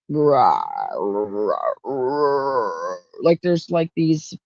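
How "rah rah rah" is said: deep animalistic growl; wordless masculine growl, drawn out from the throat; demonic guttural moan. Retold or imitated. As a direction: imitate a low, gargling, possessed moan. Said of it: "it feels deep. It's like this".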